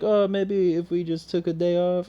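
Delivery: put-on high, light French accent. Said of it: "I did that in a French accent".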